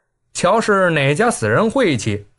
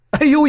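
sharp cry of pain, high; high pained yelp; 10 semitones higher, 3 dB louder than usual. A narrator's reading. Chang Changfeng hit on his toe, yelping high. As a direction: yelp as the stone hits your toe. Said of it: "一声跳将起来".

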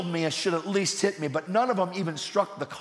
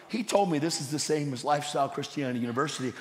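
ordinary delivery

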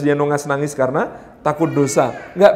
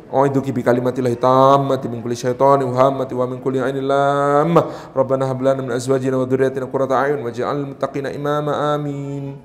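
low, level Arabic chanting; prayer recitation; 4 semitones lower than usual. Retold or imitated. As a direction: recite a blessing over a child in a steady murmur. Recites prayer over his daughter.

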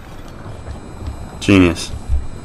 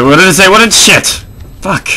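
flustered panic; 9 semitones higher than usual. Real, not acted. Missed important game text.

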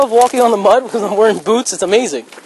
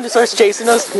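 ordinary delivery

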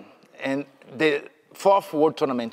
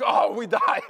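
mock exhaustion; 5 semitones higher than usual. Both fighters exhausted, acted out.

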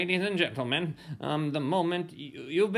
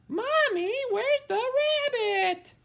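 put-on very high little kid asking mommy; small child voice. Child in audience calls out.

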